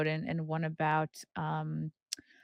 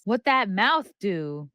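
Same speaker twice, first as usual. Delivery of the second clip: playful sassy; 2 semitones higher, 8 dB louder, slower than usual. Real, not acted. Playful slang line.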